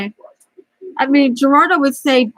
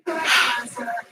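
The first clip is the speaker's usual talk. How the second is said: tearful sigh; 7 dB quieter than usual. Real, not acted. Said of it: "I can't believe I'm gonna start crying".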